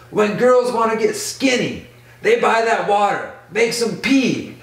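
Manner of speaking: high mock-exasperated explanation, held throughout; imitated scolding. Max explaining why girls buy it, mock scolding. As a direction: spell it out in an exaggerated high voice.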